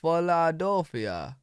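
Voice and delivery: "Philadelphia" slowly echoed as "ola-dawl-fia"; imitated local pronunciation. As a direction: copy the local "Philadelphia", drawn out with rounded vowels. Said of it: "you say Philadelphia".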